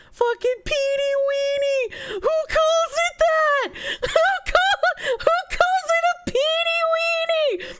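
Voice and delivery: high-pitched and breaking into laughter; laughing disbelief. Real, not acted. Reacting to chat's silly word, laughing high. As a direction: squeal it out through real laughter. Cracking up at a chat term.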